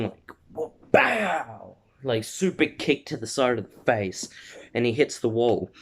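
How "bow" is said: high, drawn-out "bow" of a blow; vocal sound effect for a hit; imitated impact noise. Fan retelling a super kick, drawn-out impact noise.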